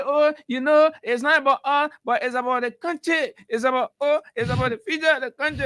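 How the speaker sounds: high, wailing imitation of a tearful politician; mock crying woman. Radio host imitating a crying party woman, mock wailing. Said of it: "crying and crying".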